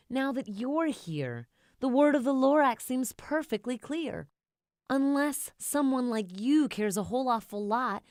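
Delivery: loud earnest Onceler plea. A narrator's reading. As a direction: urge the child sincerely.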